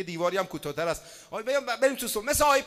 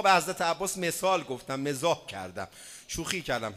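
ordinary delivery

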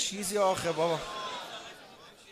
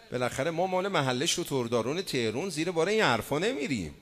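ordinary delivery

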